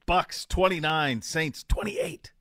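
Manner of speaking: slow, drawn-out score call, last part nearly a whisper; dramatic pick reveal. Acted. Co-host answers with his own score pick, slow and dramatic. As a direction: savor each number like a big reveal.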